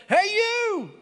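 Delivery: high, loud parrot squawk; parrot shout. Acted. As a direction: squawk out to grab his attention. Parrot calls man again.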